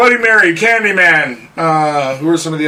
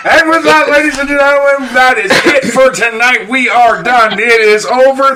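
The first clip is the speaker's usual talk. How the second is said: loud, higher host announcement; announcer sign-off; 4 semitones higher, 4 dB louder than usual. Acted. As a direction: announce the end of the show.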